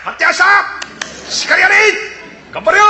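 high, loud calls from the crowd; crowd heckling. Acted. Onlookers cheer the man on, loud high heckles. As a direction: shout encouragement at the stage.

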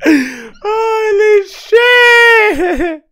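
real shock breaking into laughter; shocked laughing yell. Real, not acted. Reacting to shocking clip.

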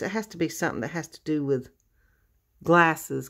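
ordinary delivery

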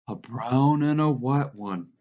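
an eager cry, lightly acted and stretched out; excited cry. A narrator's reading. Lenny asking George about the pups, excited. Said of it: "Lenny cried excitedly".

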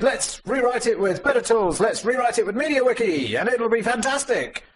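high, eager mock voice of an overconfident colleague; mimicking Bozidir's flame post, high and eager; mock enthusiasm. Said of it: "he wrote a flame post and said".

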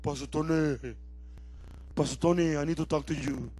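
mope down the phone in a sunken voice; depressed caller. Acted. Depressed member phoning pastor, low mopey plea. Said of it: "Somebody's depressed".

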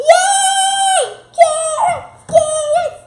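high, loud gasp and cheer; excited shout. Real, not acted. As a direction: cheer like you just won. Kid thrilled to see carrots.

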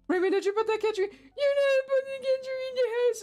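high, loud mock scolding; hammed-up outrage at a villager; mock upset. Player scolding Raymond over the cat tree, mock upset. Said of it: "And be, like, upset".

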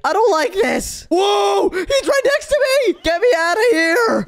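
high panicked shrieks; terrified screaming. Real, not acted. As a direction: shriek as it closes in. Chased by monster, screaming.

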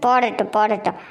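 low, dropped muttering grumble; half-voiced scheming mutter; grumbling "let her". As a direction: mutter it darkly under your breath. Grandma grumbles to herself.

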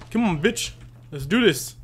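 usual speaking voice

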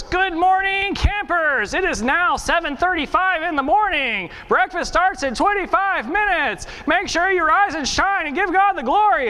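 high, singsong microphone wake-up call; mock camp announcer. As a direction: imitate an annoyingly chirpy camp loudspeaker voice. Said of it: "continue screaming in our ears every morning".

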